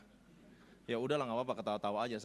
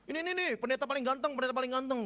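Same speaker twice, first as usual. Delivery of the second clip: high, loud mock admiration; teasing imitation; 11 semitones higher, 4 dB louder than usual. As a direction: mimic a friend's high-pitched ribbing. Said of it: "Dia ngeledekin saya".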